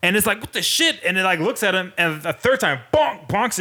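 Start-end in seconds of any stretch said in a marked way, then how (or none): 0.4-1.0 high squeaky raccoon yelp, mock surprise; startled raccoon voice. Raccoon voiced, bonked again.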